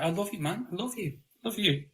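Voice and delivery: high, soft, syrupy I love you; mock gushing affection, sickly sweet; condescending. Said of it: "comes across fucking condescending".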